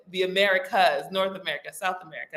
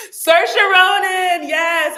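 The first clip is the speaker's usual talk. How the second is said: high, loud, stretched-out cry of recognition; excited recognition; 12 semitones higher, 9 dB louder, much slower than usual. Real, not acted. Streamer getting the forgotten actress's name, excited outburst. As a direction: burst out with it, then ease down.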